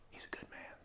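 breathy murmured whisper. Retold or imitated. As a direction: whisper it so nobody overhears. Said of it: "so they kind of whisper it".

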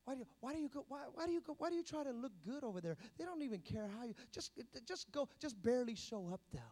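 softly voiced nagging doubter; insinuating tempter. Devil talking someone out of church, soft nagging. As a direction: talk them out of it, quietly. Voicing the devil's discouraging whisperings.

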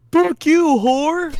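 high, loud mock scream; imitating a neighbor screaming from outside; neighbor's yell.

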